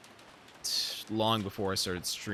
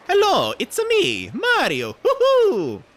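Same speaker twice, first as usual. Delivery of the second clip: very high, loud Italian video-game hero; Mario; 12 dB louder, slower than usual. Acted. Streamer showing off an Italian accent, high loud game-mascot impression. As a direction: shout it in a high, exuberant cartoon voice, drawn out. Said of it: "Or Mario. Mario works too".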